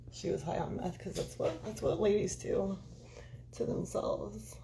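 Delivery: whispered mockery, slowing down; sarcastic whisper. Whispering sarcasm at police claim about victim. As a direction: hiss it out sarcastically.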